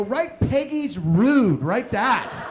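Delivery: loud, slow, hammered-out order; irate dictation. Acted. Dad ordering his son what to write, irate. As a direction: dictate it at full volume, outraged.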